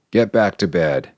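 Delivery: ordinary delivery, nothing marked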